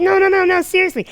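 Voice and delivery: high insistent mock girl voice; insisting girl. Imitating the girl insisting, high and pleading.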